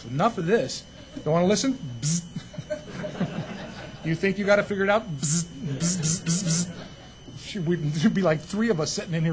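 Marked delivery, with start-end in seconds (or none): 2.0-2.2 mock zapping buzz. That's what I would do. 5.2-6.7 mock zapping buzz. That's what I would do.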